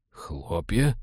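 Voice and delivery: low, soft, rising question; puzzled echo. A narrator's reading. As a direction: query it quietly in disbelief. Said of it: "с недоумением переспросил череп".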